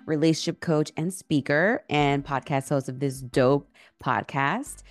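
ordinary talk, nothing marked

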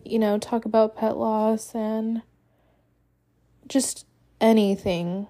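usual speaking voice

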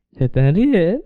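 lightly played drunk, pitch lifted; mock-drunk singsong; drunk teasing. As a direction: tease mom like a tipsy son. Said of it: "Así le dije a mi mamá".

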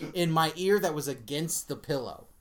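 usual speaking voice

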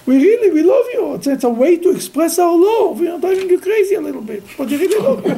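high, animated acted pleading; effusive profession of love. People voiced professing love to Moses.

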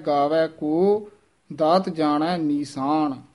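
slow ਗਾਵੈ ਕੋ, a pause, then ਦਾਤ ਜਾਣੈ ਨੀਸਾਨ; pause after ਕੋ. Shown as a demonstration. Teacher showing where the pause falls after ਕੋ. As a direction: rest on ਕੋ before going on. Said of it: "ਕੋ ਤੇ ਵਿਸਰਾਮ ਲਵਾਇਆ ਜਾਂਦਾ ਹੈ".

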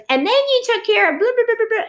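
high, loud rambling blah-blah; mock chatter. A mom recounts every nurse she knows, high mock chatter. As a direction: rattle it off high and gushing.

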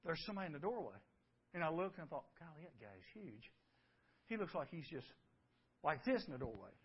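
whispered, low and scared; hushed fear. Retold or imitated. Preacher reliving boyhood fear of a figure in the doorway, hushed. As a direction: tell it like a frightened boy in the dark.

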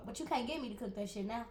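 usual speaking voice

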